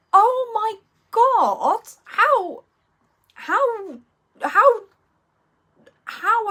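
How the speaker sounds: high, slow, stunned "oh my God"; real shock. Real, not acted. Reacting to a viewer's sad news with real shock. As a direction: let the news hit you.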